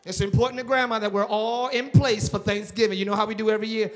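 loud insistent guilt trip; loud family pressure; pushy relative. Retold or imitated. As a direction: press them loudly about grandma. Acted family pressure over Thanksgiving.

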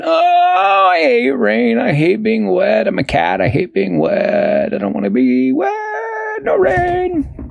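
high, fussy little cat hating the rain; high complaining cat; whiny cat voice. Acted. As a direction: whine it like a cat who hates getting wet. Cat Barnaby fusses about rain.

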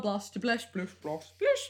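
"blast, blash, blush, blosh, blish", a new vowel each time; playful mispronunciations. Pretend. Host mocking the word "blastula", "blast" sliding through vowels to "blish". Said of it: "Potato, potato".

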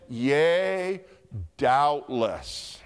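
YEA held long, then DOUBTLESS set apart after a pause; emphatic DOUBTLESS. Acted. Preacher voices God's answer to David, DOUBTLESS weighty and emphatic. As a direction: let DOUBTLESS land slowly and firmly. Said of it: "God said".

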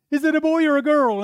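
high, loud eager mother asking; a new mother calling out excited; mother in delivery. Retold or imitated. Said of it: "she goes".

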